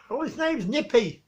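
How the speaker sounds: usual speaking voice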